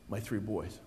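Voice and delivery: normal talking voice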